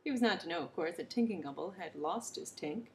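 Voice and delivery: ordinary delivery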